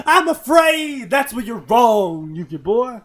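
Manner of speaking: loud slow Southern preacher Kaiba; Southern preacher accent. Shown as a demonstration. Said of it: "can I do it with a Southern preacher".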